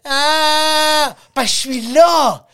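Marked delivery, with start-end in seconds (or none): 0.1-1.2 cri aigu imité. Retold or imitated. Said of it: "elle fait".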